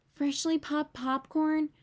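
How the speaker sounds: usual speaking voice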